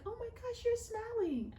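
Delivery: put-on high, amazed kid's voice; a child's high, astonished squeal; surprised child. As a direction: play the daughter catching mom smiling. Daughter voiced in retold story.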